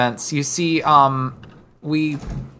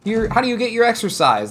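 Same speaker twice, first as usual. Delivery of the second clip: higher, louder quiz-host voice; a higher, chirpy questionnaire voice; mimicking game prompt; 10 semitones higher, 4 dB louder than usual. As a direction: read it like a bubbly game question. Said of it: "where it's like".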